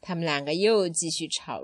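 ordinary delivery

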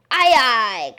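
loud pirate-game reply; big, drawn sailor's answer; hearty cry. A narrator's reading. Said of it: "cried the maid".

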